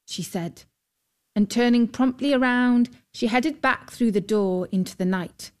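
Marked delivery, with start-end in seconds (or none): none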